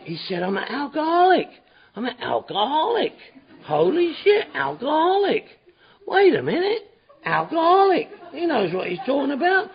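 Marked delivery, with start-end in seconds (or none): 0.4-8.1 dawning wonder. Retold or imitated. Dawning realisation, inner voice.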